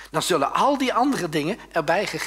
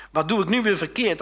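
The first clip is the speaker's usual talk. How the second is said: loud, hurt protest; why is it always me; indignant young David; 7 semitones higher, 6 dB louder than usual. Acted. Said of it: "antwoordde David".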